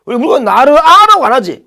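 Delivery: ordinary talk, nothing marked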